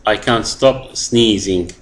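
very slow, clear example; teacher's model sentence; slow dictation. Shown as a demonstration. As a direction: say each word slowly. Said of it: "reads example sentence to class".

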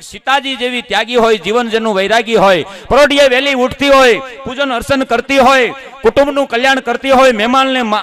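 loud rhythmic recital; poem recitation. Acted. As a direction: proclaim each line like a stage poet.

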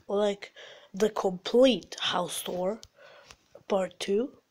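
slow, hushed, trailing words; distracted, low quiet voice. Real, not acted. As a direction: drop low and soft, dragging it out. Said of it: "Why was I quiet back there".

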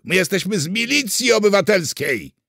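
high, loud indignant declaration; bellowing. A narrator's reading. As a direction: yell it proudly at the prisoner. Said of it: "wydarł się Miszczuk". (głośny, oburzony wrzask)

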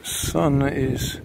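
ordinary talk

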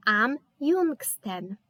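am jungsten said slowly and carefully; slow demo. Shown as a demonstration. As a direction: draw out am jungsten for the class. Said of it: "dodajemy również umlaut". (powoli, wyraźnie, jak dla uczniów)

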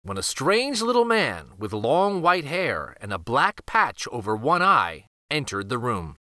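usual speaking voice

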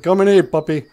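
high, loud call to the dog. Retold or imitated. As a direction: sing out to the puppy, high and loud.

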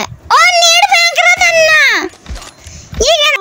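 high startled yell; panicking gamer. Real, not acted. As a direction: shout in alarm at the screen. Gamer yelling at enemy net.

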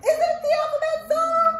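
high, loud excited puppet voice, partly sung. Acted. Puppet Arturo greeting the children, high excited voice. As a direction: bounce in as an eager little monkey puppet.

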